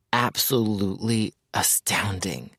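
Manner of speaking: breathless whispered praise; awed whisper. Acted. Mayers describing Hope to his friend in an awed whisper. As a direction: whisper it as if it's sacred.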